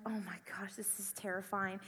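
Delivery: whispered nervous dread; scared whisper. Retold or imitated. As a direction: whisper it, frightened, under your breath. Scared aside while waiting in line.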